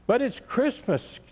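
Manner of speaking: a lightly voiced bunny whining that it's Christmas; moaning bunny. A narrator's reading. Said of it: "moaned Barrington".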